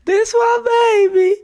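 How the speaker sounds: high, loud, sobbing cry; crying shout. Retold or imitated. Mom crying on his shoulder.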